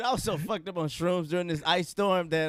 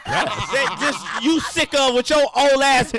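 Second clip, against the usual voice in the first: mocking roast; 7 semitones higher, 5 dB louder than usual. Real, not acted. Roasting his friend mid-argument.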